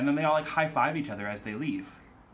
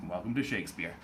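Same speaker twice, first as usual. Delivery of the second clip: ordinary talk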